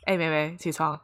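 very soft, gentle wake-up call; hushed, low-volume wake-up voice; soft-spoken mother. Retold or imitated. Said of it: "轻声细语".

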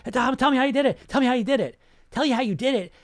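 high, loud mock pleading; eager begging fans. Mimicking TikTok commenters begging for the secret. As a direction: beg like a desperate follower.

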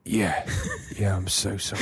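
low, soft mock-poorly apology; sick employee caller. Employee apologises to boss on sick call, low feeble voice. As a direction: apologise weakly, as if ill. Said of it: "role reversal".

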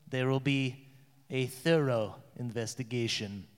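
low, grave royal voice; stern king. Acted. King addressing court after attack, low and grave. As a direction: speak as the king, gravely and quietly. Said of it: "and he goes".

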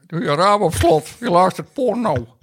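lightly voiced high impression; a hint of the nagging neighbour; imitation voice. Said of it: "het is lager".

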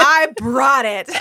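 hammed-up gallant declaration; mock-heroic boast. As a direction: announce it like a swaggering rescuer. Plays hero offering to stop fire.

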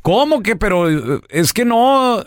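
high, taken-aback question; el feo. Acted. Poor man turned down by the devil, high puzzled voice. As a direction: squeak it in disbelief. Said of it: "Dice el feo".

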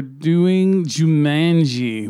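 very slow, stretched announcement; drawn out. Pretend. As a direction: stretch every word out.

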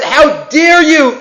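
high, slow indignant rebuke; a slow, rising how-dare-you. Retold or imitated. Moshe rebuking the tribes, slow and indignant. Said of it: "Moshe says".

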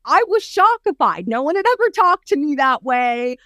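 a touch of hammed-up distress; imitating Oprah. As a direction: replay the shock of a meltdown. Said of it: "And she said".

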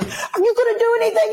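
very high, insistent mock whine; demanding complainant. Man to the officer shushing him, demanding. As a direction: imitate an upset man, pitch shot way up.

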